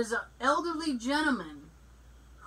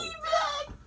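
laughing insult; 4 dB quieter than usual. Real, not acted.

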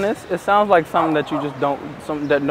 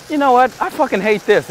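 blunt complaint; 10 semitones higher, 5 dB louder than usual. Retold or imitated. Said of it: "they're like".

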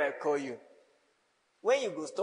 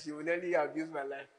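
ordinary talk, nothing marked

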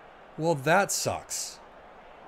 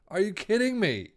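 exasperated disbelief; 5 semitones higher than usual. Real, not acted. Star player injured mid-match.

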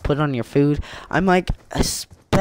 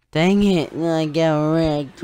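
usual speaking voice

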